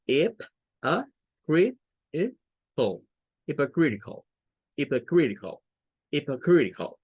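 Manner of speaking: hip, a, crit built into hypocritical; syllable by syllable. Shown as a demonstration.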